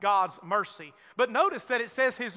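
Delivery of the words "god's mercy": ordinary talk, nothing marked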